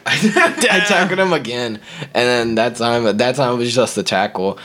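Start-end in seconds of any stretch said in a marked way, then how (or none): 0.1-1.8 laughing. Real, not acted. Laughs recounting the second tackle.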